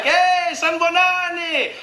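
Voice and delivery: high, drawn-out hail; called-out greeting. Retold or imitated. Hailing strangers in the street, called-out greeting. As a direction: call out a long, high hello. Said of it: "I greeted them in the streets like".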